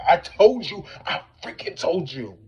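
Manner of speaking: high, loud triumphant I told you; vindicated shout. Retold or imitated. Narrator to friend after he saw the reptilian, loud triumphant shout. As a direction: crow it, totally vindicated. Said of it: "I was like".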